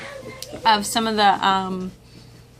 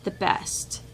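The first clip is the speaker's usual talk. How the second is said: normal talking voice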